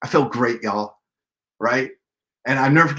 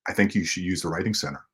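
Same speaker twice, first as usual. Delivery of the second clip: much lower, quiet dry voice; deadpan dean; 11 semitones lower, 8 dB quieter than usual. Retold or imitated. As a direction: say it low and dry, unimpressed. Dean's deadpan advice.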